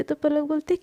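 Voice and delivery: plain reading voice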